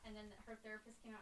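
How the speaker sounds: ordinary talk, nothing marked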